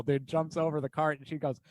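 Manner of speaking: ordinary talk, nothing marked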